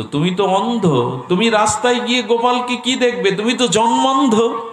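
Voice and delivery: high-pitched, insistent acted protest; incredulous objection. Family challenges a blind elder. (চড়া গলায় অবিশ্বাসের আপত্তি)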